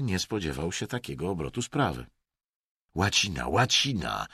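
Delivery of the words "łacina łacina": low, impatient snap. A narrator's reading. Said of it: "warknął Emerson ze zniecierpliwieniem".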